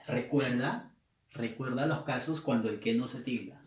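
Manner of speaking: ordinary delivery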